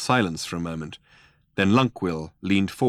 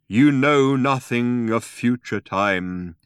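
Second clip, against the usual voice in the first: pronouncing; 4 semitones higher, 6 dB louder, slower than usual. A narrator's reading. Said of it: "pronounced Deep Thought".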